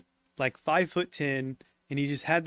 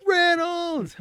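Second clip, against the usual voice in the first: high, strained mock scream; retold scream, pitched way up; imitated screaming; 14 semitones higher, much slower than usual. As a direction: call out the name high and drawn out, imitating a panicked buddy. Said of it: "Shiloh just screaming".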